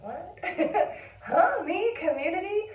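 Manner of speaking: high, laughing squeaks of disbelief; mock disbelief. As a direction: play it as "who, me?" with a laugh. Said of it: "which for me has always been like this, kind of like".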